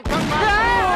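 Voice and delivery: calling out. Acted. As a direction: shout it across to hurry him. Calling someone to hurry.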